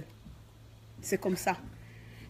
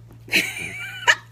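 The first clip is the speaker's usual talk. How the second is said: a loud high laugh; 3 dB louder than usual. Real, not acted. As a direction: burst of high-pitched laughter. Laughing after her boast.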